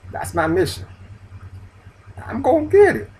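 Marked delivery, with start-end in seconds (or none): 2.2-3.1 determined shout. Real, not acted. Declaring his mission.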